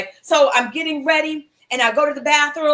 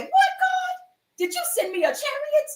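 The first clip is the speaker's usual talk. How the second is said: amazed delight; 14 semitones higher than usual. Retold or imitated. Asking God about the cab, a very high amazed cry. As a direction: shriek like you just got a miracle.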